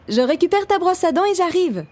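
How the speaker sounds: high, carrying mum voice; mother calling back. A narrator's reading. Mother calling down to her waiting son, high call-back. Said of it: "lance-t-elle".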